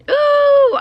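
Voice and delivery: high, loud "ooh"; excited whoop. Real, not acted. Vlogger celebrating her grocery savings, excited whoop. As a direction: let out a high, held ooh.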